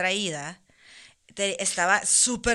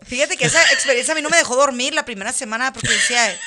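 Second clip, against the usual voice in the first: laughing while talking; 6 semitones higher, 8 dB louder than usual. Real, not acted.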